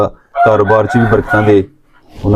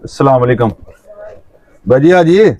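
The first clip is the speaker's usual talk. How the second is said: higher-pitched call at the door; calling out to enter; 5 semitones higher than usual. Real, not acted. Calling before entering the home. (ਅੰਦਰ ਆਉਣ ਲਈ ਉੱਚੀ ਸੁਰ ਵਿੱਚ ਆਵਾਜ਼ ਦੇਣੀ)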